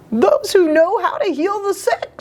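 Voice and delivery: high monkey voice. Acted. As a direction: play the cheeky monkey. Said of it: "said Gogol".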